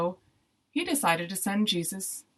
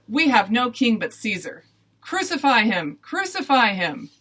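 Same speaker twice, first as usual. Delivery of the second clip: loud, higher defiant shout; crowd shouting; 5 semitones higher, 7 dB louder than usual. A narrator's reading. Crowd answers Pilate about their king, shouting. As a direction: shout it back as a defiant mob.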